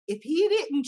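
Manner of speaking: ordinary talk, nothing marked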